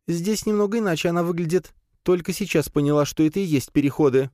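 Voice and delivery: high, feminine voice; spider character. A narrator's reading. Spider reports the exits to the hero, high voice. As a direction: speak high as the small spider companion.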